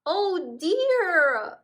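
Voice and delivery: high, loud worried gasp. Acted. Mole hears Smudge has left home with a high loud worried gasp. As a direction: gasp it out in dismay. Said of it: "Mole gasped".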